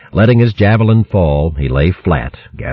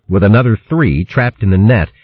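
ordinary delivery, nothing marked

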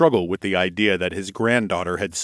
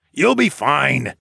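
slow, raised call across a gap; 6 semitones higher, 4 dB louder, slower than usual. A narrator's reading. Grandfather to mage crossing planks, calling out. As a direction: call it over, drawing out the words. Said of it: "Darwin called out to the blonde mage".

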